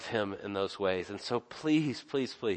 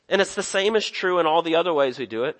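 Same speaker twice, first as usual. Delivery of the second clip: higher and louder, pressing the point home; preaching at full force; 6 semitones higher, 6 dB louder than usual. Acted. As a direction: preach it hard and personal. Preacher's rising passage.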